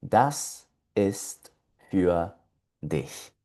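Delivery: "Das ist ... für ... dich", slow with pauses; low, separated words, dropping softly on dich; word-by-word model. Shown as a demonstration. Teacher repeating the expression for learners, slow and broken up. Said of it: "toda la expresión se lee".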